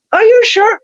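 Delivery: mock worried question; incredulous doubt. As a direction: ask it in playful disbelief. Physical self doubting higher self.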